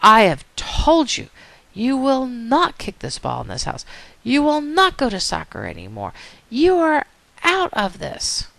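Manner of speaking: high, emphatic, measured scolding; acted stern mom, word by word; mom at her limit. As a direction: play a mother laying down a final punishment. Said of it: "the mom grabs it and says".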